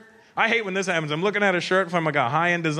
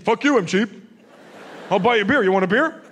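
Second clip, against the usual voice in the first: loud defensive offer; 2 semitones higher, 4 dB louder, faster than usual. Acted.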